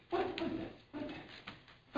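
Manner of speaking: whispery puzzled questioning; hushed inspection. Acted. Parent spotting a smudge on child, hushed questioning. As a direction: peer closely and ask under your breath.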